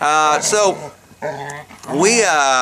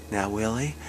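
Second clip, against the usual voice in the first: put-on mother to toddler; 3 semitones lower, 5 dB quieter than usual. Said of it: "gets down on her knee and she goes".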